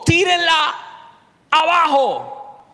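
high, forceful shout with a long last word; shouted order. A narrator's reading. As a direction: call the order out loud, stretching the end. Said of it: "perdón, gritó". (orden a gritos, aguda y alargada)

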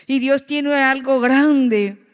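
loud, slow and high proclamation; preacher's fervent slow declaration; preaching. Acted. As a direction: proclaim it to the congregation.

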